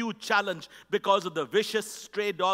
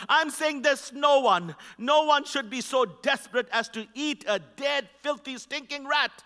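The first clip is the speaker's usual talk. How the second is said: emphatic preaching; 6 semitones higher, 4 dB louder than usual. Acted. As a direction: preach it with full conviction.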